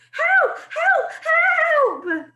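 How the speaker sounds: very high, loud cry for help; a boy screaming for help at the door; terrified scream. Acted. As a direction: scream for your life. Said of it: "I screamed".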